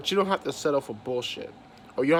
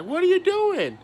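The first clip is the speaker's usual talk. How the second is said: mocking the boss; 6 dB louder than usual. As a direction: boss mocked in a much higher, louder voice. Boss quoted in a workplace rant.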